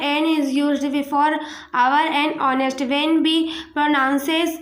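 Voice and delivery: very slow, word by word; slow dictation. Shown as a demonstration. Teacher to class, dictating the silent-h rule slowly. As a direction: spell the rule out for the class to write.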